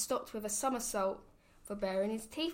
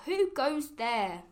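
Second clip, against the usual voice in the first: mock cry; 4 semitones higher, 5 dB louder, slower than usual. A narrator's reading. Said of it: "who cried out".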